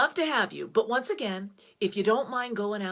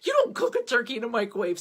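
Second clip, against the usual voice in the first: louder, fast scolding mother; 3 semitones higher, 6 dB louder than usual. Retold or imitated. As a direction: scold her like an outraged mother. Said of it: "my mother would have called me up and go".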